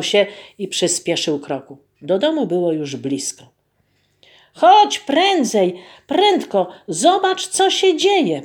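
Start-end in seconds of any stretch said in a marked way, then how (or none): 4.5-8.4 high, loud urgent call, slowed at the start; urgent wife. Acted. Wife greeting husband, calling him urgently to look. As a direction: hurry him over to see. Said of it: "powitała go żona".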